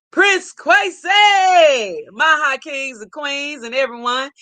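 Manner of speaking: high, drawn-out holler; big stretched-out shout of welcome; calling out greeting. Real, not acted. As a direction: sing out the name in a long, high call.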